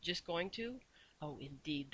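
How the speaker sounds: softly menacing question; soft, fierce, terrifying. A narrator's reading. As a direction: keep the fury hushed and dangerous. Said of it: "in a soft, fierce, terrifying voice".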